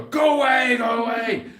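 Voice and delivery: loud, high shout to scare animals off; shooing shout. Real, not acted. Owner shouting the charging donkeys away, loud and high. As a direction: drive the donkeys back with your voice.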